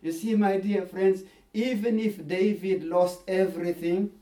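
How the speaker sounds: ordinary talk, nothing marked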